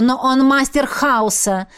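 ordinary delivery, nothing marked

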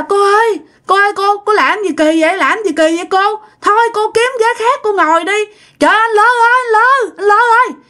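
high-pitched alarmed calling out; distressed protest. Acted. Passenger calls the bus attendant about a seat-stealing woman, high distressed protest. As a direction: protest urgently, pitch pushed way up.

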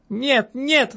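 high-pitched protesting cry; woman's high outburst, not a full shout. A narrator's reading. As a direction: cry out in denial, voice leaping up. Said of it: "закричала я".

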